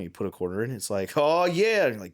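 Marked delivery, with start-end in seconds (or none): 1.2-1.8 high, loud announcer cheer; high, loud mock announcer; hype announcer. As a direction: cheer like the cabinet. Machine announcer reacts.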